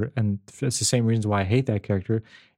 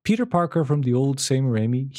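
ordinary delivery

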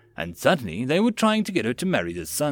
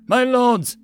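breathless, high, loud cry; urgent panting report; 12 semitones higher, 7 dB louder than usual. A narrator's reading. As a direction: gasp it out, desperate. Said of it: "A breathless messenger".

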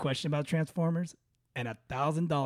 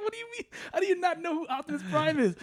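high, disbelieving mock outrage; incredulous; 13 semitones higher, faster than usual. Son questioning dad about Optimus Prime, high disbelief. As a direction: act stunned he doesn't know. Quoting own shock at dad.

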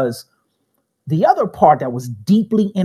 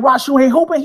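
higher, louder mock surprise at a friend; surprised question; 8 semitones higher, 5 dB louder, faster than usual. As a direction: ask a teammate why he quit. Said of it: "I was like".

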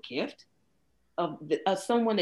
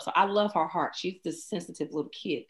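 ordinary talk, nothing marked

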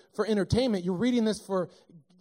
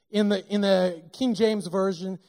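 ordinary talk

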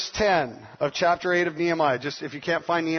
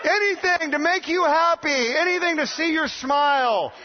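eager promise; 10 semitones higher than usual. Retold or imitated. Father offering daughter anything to make her happy, eager.

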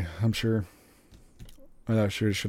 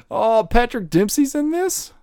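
much higher and louder, slow, amazed; surprised; 14 semitones higher, 7 dB louder, slower than usual. Real, not acted. Finding Patrick Dempsey in the movie cast, real surprise. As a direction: genuine delighted surprise at a discovery. Discovers actor in cast list.

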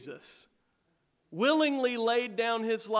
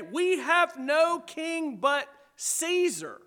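clergy declaration; 7 semitones higher, much slower than usual. Retold or imitated. Preacher repeating the religious leaders' words, slow and high. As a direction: drag the line out as the clergy's pledge. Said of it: "from the lips of the clergy".

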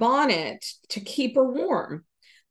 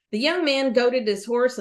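ordinary delivery

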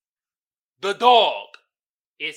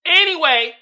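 loud, high hype announcement; loud, high Meme Time announcement; hyped segment intro; 7 semitones higher, 16 dB louder than usual. Real, not acted. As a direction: announce the segment like a show host.

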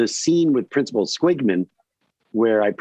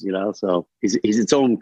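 usual speaking voice

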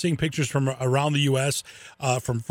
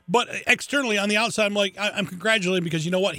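ordinary delivery

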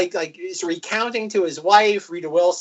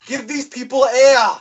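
callback shout; 7 semitones higher, 5 dB louder than usual. Retold or imitated. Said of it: "movie line callback".

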